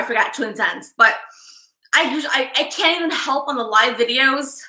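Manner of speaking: usual speaking voice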